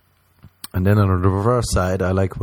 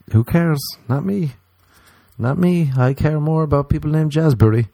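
higher, dismissive not me; 6 semitones higher than usual. Retold or imitated. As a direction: brush it off up high. Dismissive ranting quote.

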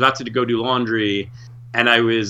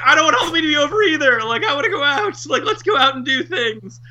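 put-on high, whining kid voice; mock childish whine; 13 semitones higher than usual. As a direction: whine like a kid who wants to go out. His own whiny childish reply.

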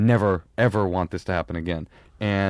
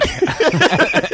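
a sudden high squeal of a laugh; high burst of laughter; 6 dB louder than usual. Real, not acted. Laughing high while retelling the gangster story. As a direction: laugh out high and loud at the memory.

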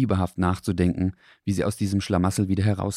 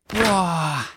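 high, amazed Boah; a little dog stunned by the open fridge; amazed gasp; 11 semitones higher than usual. A narrator's reading. Dog gasps in amazement at the fridge, high and awed. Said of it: "sagte Dackel".

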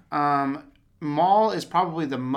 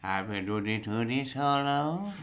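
stepdad impression; 3 semitones lower than usual. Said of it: "Was that an impression of our stepdad?".

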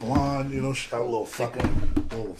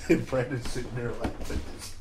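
ordinary talk, nothing marked

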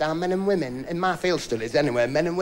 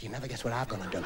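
put-on lower, much softer girlfriend; 8 semitones lower, 12 dB quieter than usual. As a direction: hush it down low. Girlfriend voiced low and soft.

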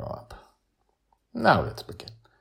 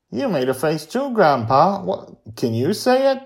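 put-on high, loud child's question; challenging young Joy; 12 semitones higher, 7 dB louder than usual. A narrator's reading. Girl dares her grandpa to curse, high and challenging. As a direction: put him on the spot like a kid.

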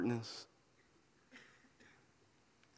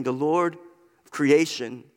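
normal talking voice

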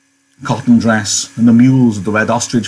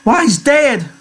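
high-pitched cry of shock; slower than usual. A narrator's reading. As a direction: exclaim it in a high woman's character voice. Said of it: "she cried".

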